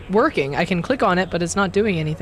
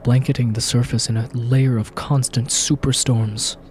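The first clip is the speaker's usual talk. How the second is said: low, grave trailer narrator voice; dramatic narration; 6 semitones lower than usual. A narrator's reading. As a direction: read it like an epic movie intro. Reads game intro as narrator.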